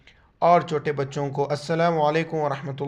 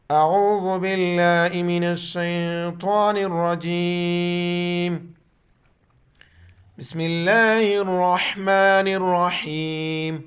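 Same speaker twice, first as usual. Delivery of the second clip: slow, measured recitation tone; Quranic recitation; much slower than usual. Real, not acted. Teacher begins the lesson, recites in tajweed style. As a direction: recite it reverently, drawn out.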